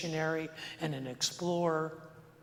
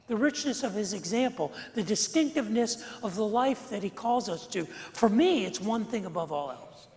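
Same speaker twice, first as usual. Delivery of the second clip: louder, impassioned preaching; 5 semitones higher, 4 dB louder than usual. Acted. Pastor pleading with his congregation, impassioned preaching. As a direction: lean in and press the point.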